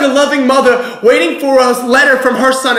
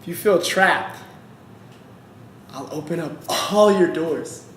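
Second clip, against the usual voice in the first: God's gentle promise; 6 semitones lower, 8 dB quieter than usual. Acted. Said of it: "and he says".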